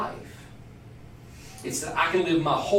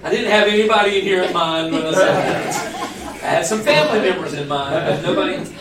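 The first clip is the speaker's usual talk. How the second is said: loud laughing; 3 semitones higher, 8 dB louder than usual. Real, not acted. Caught out by a joke.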